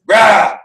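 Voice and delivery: short, loud mimicked bark; curt growled retort. Hoover snapping at Roosevelt beside him, loud gruff grunt. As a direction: imitate a gruff, wordless snap. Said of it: "and goes".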